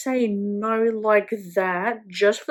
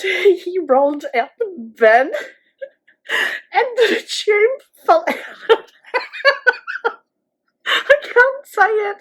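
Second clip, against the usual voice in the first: high-pitched helpless laughter, louder; laughing too hard to get the words out; real giggling fit while telling the story; 11 semitones higher, 6 dB louder than usual. Real, not acted. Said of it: "because I can't stop laughing".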